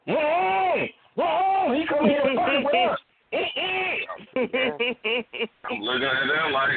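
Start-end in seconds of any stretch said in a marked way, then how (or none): 0.1-4.0 high, disapproving tutting and sucked-teeth scolding; mock the intruder like a disapproving crowd member; scolding onlookers. Retelling the fight, voicing the crowd's disapproval, higher and tutting.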